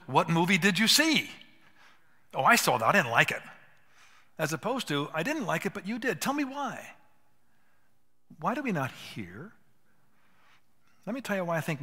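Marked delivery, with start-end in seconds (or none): 0.1-1.3 loud, eager question; really want to know, asking loudly; curious friend. Acted. Pastor voicing a curious friend at lunch, loud and eager. 2.3-3.4 loud, offhand brush-off; dismissive friend. Acted. Pastor voicing a friend who cuts the talk short, loud and dismissive. 5.1-7.0 climbing higher into an eager question; curious listener. Acted. Pastor voicing a friend who truly wants to hear, rising and eager.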